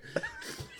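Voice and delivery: squeaky giggle. Real, not acted. Laughing at his own silly voice.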